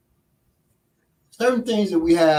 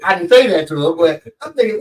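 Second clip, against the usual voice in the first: ordinary talk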